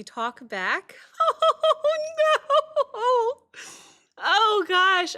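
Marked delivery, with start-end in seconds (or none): 1.0-5.2 high, loud wail through a laugh; really moved, let it burst out very high; overwhelmed with emotion. Real, not acted. Overcome by the song's moving question.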